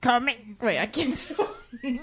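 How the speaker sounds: giggling through the words; giving up on a voice impression, laughing. Real, not acted. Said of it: "That was definitely not".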